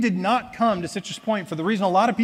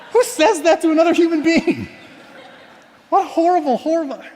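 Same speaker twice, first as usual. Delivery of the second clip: much higher, louder, laughing through the words; real laughter at his own awkward remark; cracking up while scolding himself; laughing in disbelief; 11 semitones higher, 6 dB louder than usual. Real, not acted.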